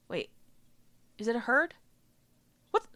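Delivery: ordinary delivery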